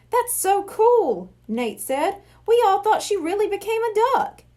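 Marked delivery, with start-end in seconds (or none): 0.1-1.3 higher, louder, slow excited gush; delighted. Acted. Kid delighted by the prank. 2.4-4.4 put-on excited kid. Kid excited about the prank.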